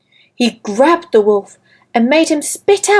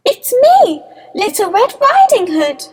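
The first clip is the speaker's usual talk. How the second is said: put-on little girl voice; 10 semitones higher than usual.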